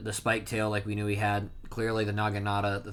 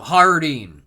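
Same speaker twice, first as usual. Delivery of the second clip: loud, higher mock shout; retold yell; 4 semitones higher, 11 dB louder than usual. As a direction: call the name out as if to stop him. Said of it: "they yell".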